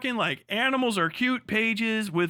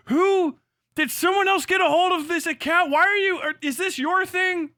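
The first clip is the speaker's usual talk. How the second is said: higher, louder exasperated rant; 10 semitones higher, 5 dB louder than usual. Real, not acted. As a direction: loudly demand an answer, baffled. Outraged at his algorithm.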